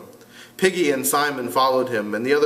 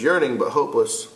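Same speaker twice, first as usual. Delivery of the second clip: ordinary delivery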